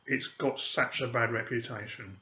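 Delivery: normal talking voice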